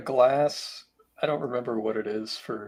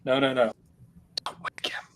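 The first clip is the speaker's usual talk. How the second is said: retold hushed warning. Hushed retold warning about sleeper.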